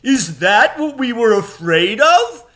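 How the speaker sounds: high, loud scornful jeer; mocking creature. A narrator's reading. Another enemy mocking Aslan, high and loud. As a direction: scoff at their own fear. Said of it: "said another".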